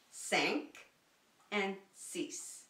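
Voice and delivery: cinq nasal, six as "sees"; French numbers. Shown as a demonstration. Teacher counts in French for the class: cinq, six. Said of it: "in French".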